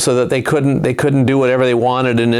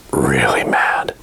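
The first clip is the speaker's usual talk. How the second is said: hushed; 12 semitones lower, slower than usual. Acted. Storyteller stressing Samson's anger.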